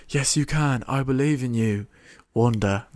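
bad British accent. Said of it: "My accent is so bad".

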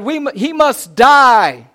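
high, loud, drawn-out demand; shouting. Acted. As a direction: shout it slowly as a death sentence. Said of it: "And he shouted".

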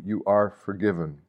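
ordinary talk, nothing marked

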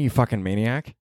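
ordinary talk, nothing marked

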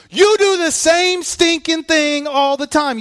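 high, loud and slow; emphatic rebuke. Real, not acted. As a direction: drive the point home.

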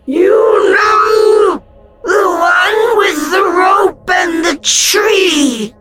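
high, slow, cruel taunt; menacing. A narrator's reading. Ghost reminding Alf of the rope and tree, menacing. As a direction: twist the knife. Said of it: "her voice dripping with menace".

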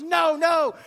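high, loud alarmed "no, no"; alarmed shout. Retold or imitated. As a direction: stop the kids from the cliff edge. Mom stopping kids at canyon edge.

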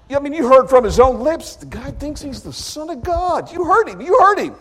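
high, loud, accusing rant; indignant high priest. Acted. High priest demanding the council convict Jesus. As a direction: rouse the council against him.

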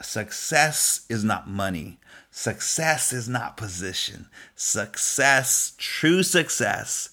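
slow, deliberate, each phrase weighed; slow emphatic preaching. Real, not acted. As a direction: preach it slowly, word by word. Sermon climax, slow emphatic.

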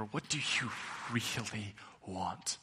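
"really want?" drawn out slowly, leaning on "really"; slow emphatic question. Shown as a demonstration. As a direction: stretch "really" and let "want?" fall soft and slow. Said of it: "Not what do you think you should want".

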